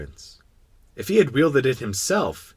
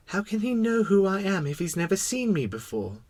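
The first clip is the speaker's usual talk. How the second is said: high-voiced puzzled little prince; wondering little prince; 10 semitones higher than usual. Little prince wondering how the king knows him, puzzled. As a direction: wonder aloud in a small high voice. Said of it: "the little prince wondered".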